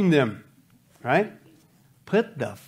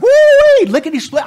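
excited; 11 dB louder than usual. Acted. Excited preacher acting out eagerness.

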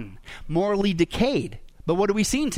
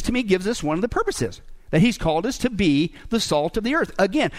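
ordinary talk

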